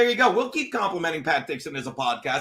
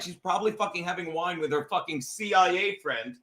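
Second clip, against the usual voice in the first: normal talking voice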